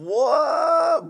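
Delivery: loud high stunned shout of disbelief; shocked shout. Real, not acted. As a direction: blurt it out in disbelief. Shocked by story twist.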